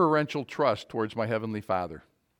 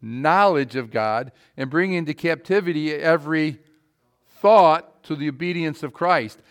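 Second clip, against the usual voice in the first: loud, driving sermon voice; fiery preaching; 4 semitones higher, 8 dB louder than usual. Acted. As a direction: preach it hard to the congregation. Preaching at full force.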